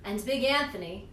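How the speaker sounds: usual speaking voice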